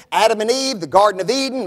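ordinary talk, nothing marked

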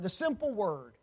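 normal talking voice

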